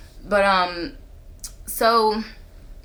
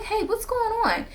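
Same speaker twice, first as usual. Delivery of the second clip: much higher sing-song hey; bright check-in; 14 semitones higher than usual. Retold or imitated. Texting the friend who pulled away, bright and light. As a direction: reach out lightly, upbeat.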